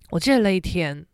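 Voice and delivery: usual speaking voice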